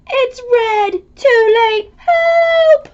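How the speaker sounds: high, loud shout for help; frightened yell as the waves take over; panicked cry. Pretend. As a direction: cry out like you're going under. Wave-meter hits ninety percent.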